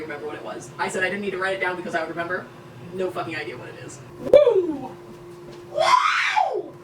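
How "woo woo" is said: high loud whoops; whooping. Real, not acted. Celebrating in the kitchen with loud high whoops. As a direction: cheer with a shout.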